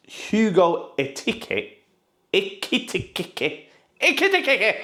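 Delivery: Ekitike drawn out slowly, then Ekitike squeaked high; struggling to say the name. Shown as a demonstration. Vlogger mangling the striker's name, stumbling aloud. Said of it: "It's like I'm having a stroke".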